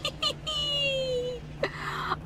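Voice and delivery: thin, soft, high-pitched tee-hee squeal; squeaky gleeful giggle trailing the whee; high playful giggle. Pretend. Playful hopping through the game.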